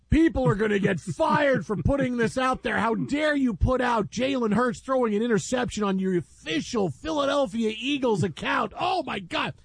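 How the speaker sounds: high-pitched scandalized hysteria; hammed-up horrified indignation; mock outrage. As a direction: imitate overwrought fans clutching pearls. Mimicking online outrage at video.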